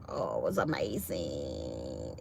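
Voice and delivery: low, soft, drawn-out relish; savoring. Real, not acted. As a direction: stretch AMAZING out in a low hush. Savoring the salad, said two ways.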